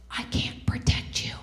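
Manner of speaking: flat, frightened whisper. Retold or imitated. Boyfriend refusing to check the door, in a scared whisper.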